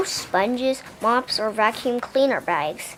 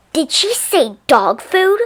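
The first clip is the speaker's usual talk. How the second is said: high, loud and slow angry question; angry witch; 6 semitones higher, 5 dB louder than usual. A narrator's reading. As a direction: spit out the question in outrage.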